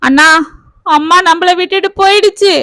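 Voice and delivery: breaking the news in grief; crying out. Acted. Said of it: "என்று கத்தினாள் கீதா". (கதறியபடி கத்தி)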